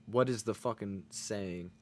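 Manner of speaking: normal talking voice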